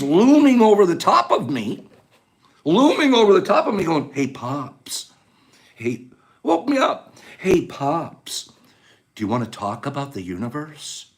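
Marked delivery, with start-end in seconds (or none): 4.1-11.1 soft low coaxing voice, softer than the telling; mock quiet wake-up. Joe quietly waking Pops to talk, soft and coaxing. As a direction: gently nudge a sleeping friend awake.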